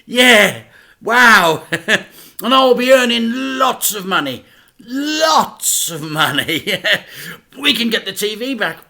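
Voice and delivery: a giddy dad laughing through it; laughing excited dad. A narrator's reading. Dad tells the boys, loud and laughing.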